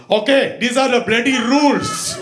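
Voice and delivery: put-on loud, high Indian-accented scolding; barked morning orders, Indian accent; mean Indian grandpa. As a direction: lay down the law like a strict Indian grandpa. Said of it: "That's what he talked to us in the morning".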